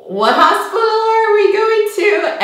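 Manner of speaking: high, loud, drawn-out question; exaggerated imitation of an oblivious partner; mock clueless husband. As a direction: stretch it out in a goofy high voice.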